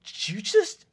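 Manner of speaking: halting start bursting into a high shriek; sputter, then screech in disbelief; outraged, disbelieving yell; incredulous bully. Acted. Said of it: "He looks at you and says".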